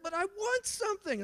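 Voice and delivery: put-on high whining little boy; whiny son voice. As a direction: whine it like a kid wanting a toy. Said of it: "He's like".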